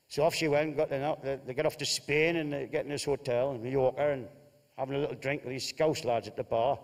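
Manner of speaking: normal talking voice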